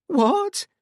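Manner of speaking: very high, loud shocked cry. A narrator's reading. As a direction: blurt it out in shock. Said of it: "sounding shocked".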